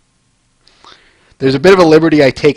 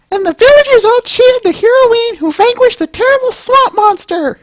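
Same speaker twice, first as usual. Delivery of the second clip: falsetto narrator. Acted. Narrator wrapping up the comic, high storybook voice. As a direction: announce the happy ending in falsetto.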